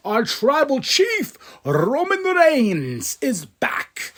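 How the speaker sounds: high, drawn-out grand introduction; ring announcer hype. Acted. Recapper revealing Roman Reigns' return, announcer hype. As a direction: stretch the name like a ring announcer.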